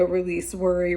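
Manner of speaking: ordinary delivery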